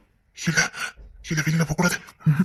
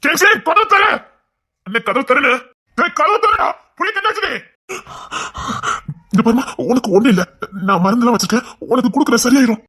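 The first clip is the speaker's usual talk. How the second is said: high, loud calls for help; frantic shouting; 5 semitones higher, 5 dB louder than usual. Acted. Husband shouting for the door to be opened while his wife is in labour, frantic. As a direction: yell over the chaos to get the door open.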